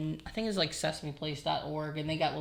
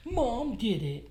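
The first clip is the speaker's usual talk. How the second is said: put-on higher, slow sulky kid; sulky toddler; 7 semitones higher, slower than usual. Toddler blaming mom, higher and slow. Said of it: "he'd be like".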